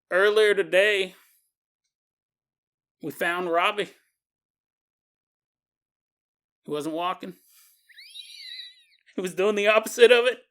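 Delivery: put-on halting country sheriff accent, long pauses; sheriff's accent. Sheriff telling a mother her son's dead, lightly put-on country accent. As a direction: deliver bad news piecemeal in a folksy lawman accent. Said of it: "I don't know why I gave him this accent".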